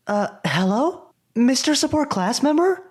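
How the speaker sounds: hesitant, then a high, loud call into the building. Acted. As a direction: call out unsure if anyone's there. Calling into the ruined club room.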